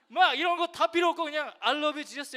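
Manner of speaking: high and loud, all fired up; almost shouting the punchline, high; excited loud retelling. Retold or imitated. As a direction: blurt it out like the bold kid. Mimics the kid's loud pitch.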